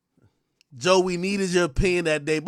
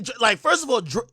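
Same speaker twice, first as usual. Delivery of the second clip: ordinary talk, nothing marked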